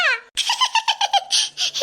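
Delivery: high, breathy cackle of a prankster; scheming laugh. Acted. Girl who just thought of a prank, gleeful scheming cackle. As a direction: laugh like you just cracked the perfect plan. Plotting a prank, gets idea.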